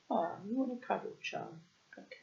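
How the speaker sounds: ordinary delivery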